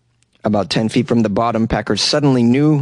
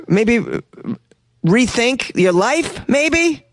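mocking; 10 semitones higher, much slower than usual. Real, not acted. Mocking advice to Joe.

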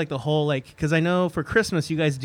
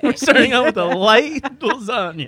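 laughing; 5 semitones higher than usual. Acted. Joking, laughing.

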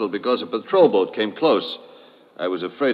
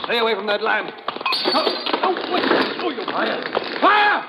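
high panicked cries, ending in a scream; 12 semitones higher than usual. Acted. Villain panicking as the fire starts, screaming. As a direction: terror rising to a shriek.

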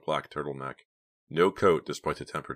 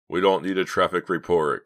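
loud, mildly irritated snap; snapping; 3 semitones higher, 8 dB louder than usual. A narrator's reading. Getaway leader shutting up his chatty partner, snapping. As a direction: cut him off with a forceful retort. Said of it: "Clay snapped".

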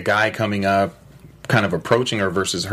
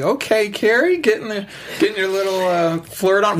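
teasing; 12 semitones higher than usual. Retold or imitated. Teasing Carrie about flirting.

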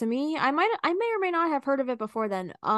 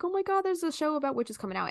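recalled excitement, pitched up; excited; 6 semitones higher than usual. Retold or imitated. Recalling a new witch show announcement, excited. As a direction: retell it as a thrilled gasp of news. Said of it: "I got super excited".

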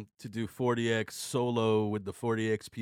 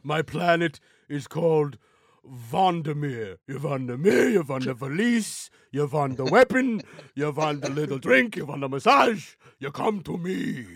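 gruff gravelly dwarf voice; 4 dB louder than usual. Acted. Naming planets in dwarf voice.

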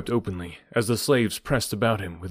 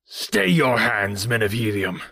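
slow, raised call to stay the crowd; trembling with rage; slower than usual. Acted. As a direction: shout it out, choking on rage. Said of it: "he shouted, his voice trembling with rage".

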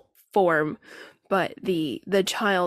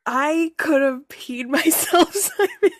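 laughing; 7 semitones higher, 5 dB louder than usual. Real, not acted. Laughing after being scared.

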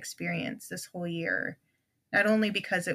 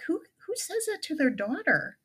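incredulous; 10 semitones higher than usual. Retold or imitated. Incredulous retort to critics.

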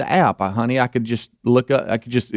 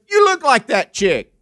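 high, slow dopey voice; imitating the guy; 13 semitones higher than usual. Retelling the customer telling the clerk she looks like Greta, high and slow. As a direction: deliver the insult slow and oblivious. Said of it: "he goes".

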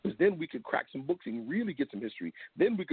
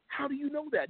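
high, astonished "how do you know that"; shocked question; 12 semitones higher than usual. Retold or imitated. Imitating Brother Webbe's shocked question to the Lebanese man, high and amazed. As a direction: ask it like you can't believe he knows. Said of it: "He was shocked".

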